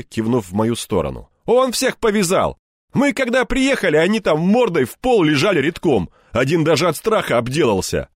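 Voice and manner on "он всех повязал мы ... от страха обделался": higher, louder excited colleague; бойкий рассказчик-коллега. Acted. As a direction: tell the tale with lively bravado, pitched up. (оживлённый рассказ коллеги, повышенным голосом)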